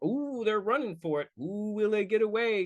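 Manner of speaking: high, put-on "ooh" of suspense; hammed-up high-pitched thrill; mock suspense. Voicing suspense of set pieces.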